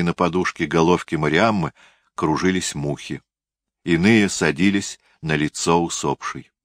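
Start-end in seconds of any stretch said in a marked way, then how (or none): none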